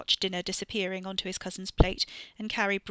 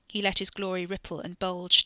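ordinary delivery

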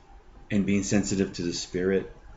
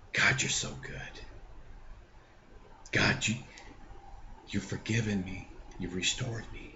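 hushed, softer whispered prayer, holding back tears; emotional whisper; 12 dB quieter than usual. Real, not acted. Telling God of forgiveness, softly whispered. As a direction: whisper it to God, moved and grateful. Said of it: "try not to get emotional".

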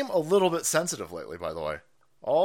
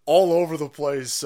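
ordinary delivery

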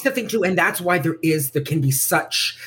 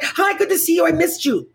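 friendly hello; 11 semitones higher than usual. Retold or imitated. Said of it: "it can just be like".

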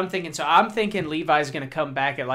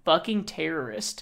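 ordinary talk, nothing marked

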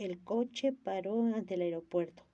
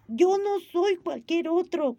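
slow, much higher, loud enraged shout; angry shout; 9 semitones higher, 8 dB louder, slower than usual. A narrator's reading. Gigi furious at being called like any other. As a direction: yell each word in fury. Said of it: "gritó Gillo encolerizado".

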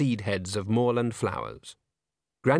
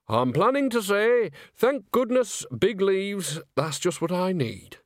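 put-on high old woman; 9 semitones higher than usual. Nanny's high voice.

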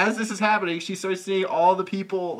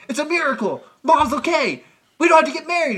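higher, louder cheery announcement; mock joyful news; 9 semitones higher, 5 dB louder than usual. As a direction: burst in with glee. Johnny voiced relieved.

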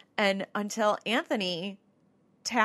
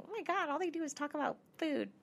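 high, quiet mock complaint; whiny reviewer; 9 semitones higher, 6 dB quieter than usual. Host mimics a one-star review of the show, mock whining. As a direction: whine it softly like a put-out listener.

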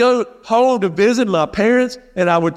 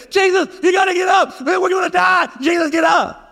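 much higher, frantic shouting to wake him; panicked shout; 11 semitones higher than usual. Acted. Terrified disciples shouting at sleeping Jesus, panicked and high. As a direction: yell it like the boat is sinking.

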